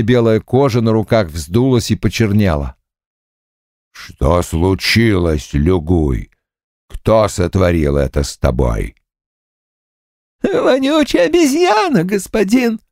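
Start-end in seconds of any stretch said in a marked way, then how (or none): 3.9-9.0 demon bull voice. Acted. 10.4-12.8 high, burnt ghost mumbling through swollen lips. Acted. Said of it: "промямлил призрак".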